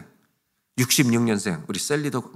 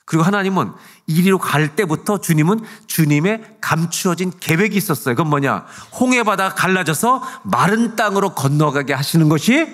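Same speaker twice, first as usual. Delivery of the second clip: raised, louder pulpit delivery; impassioned preaching; 6 semitones higher, 6 dB louder than usual. Acted. Pastor declares God's hidden plan to the congregation, impassioned preaching. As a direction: preach it out with force.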